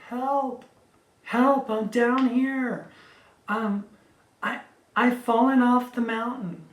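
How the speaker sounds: high, loud cries for help; yell for help. Retold or imitated. Trapped woman yells up to passing strangers for help, loud and high. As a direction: call up the mountain, desperate to be heard. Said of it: "I let out a yell".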